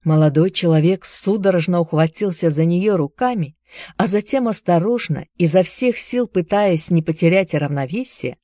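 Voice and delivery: ordinary delivery, nothing marked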